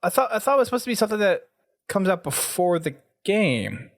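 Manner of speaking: ordinary talk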